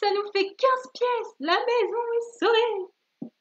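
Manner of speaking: very high, slow, celebratory child badger; stretched, high-pitched acted joy; triumphant exclamation. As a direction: proclaim the happy ending in a little character voice. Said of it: "puis s'exclama".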